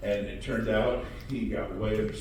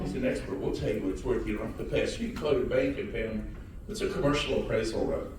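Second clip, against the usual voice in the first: normal talking voice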